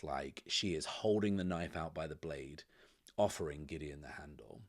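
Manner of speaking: ordinary talk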